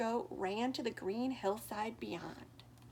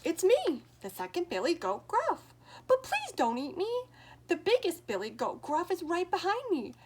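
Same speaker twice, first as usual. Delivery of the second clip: higher, louder middle goat begging; pleading goat; 6 semitones higher, 7 dB louder than usual. Acted. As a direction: plead quickly with the troll. Second goat begs troll.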